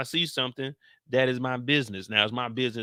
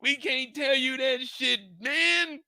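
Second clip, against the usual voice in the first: much higher, slowed-down yell; high mock yell; 13 semitones higher, much slower than usual. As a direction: blow up at him, hammed up. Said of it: "Tommy's like".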